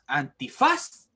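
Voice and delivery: "antifaz" with FAZ hit hard at the end; teacher's demo of "antifaz", punched FAZ; stress on last syllable. Shown as a demonstration. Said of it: "esa es la última sílaba donde estamos cargando".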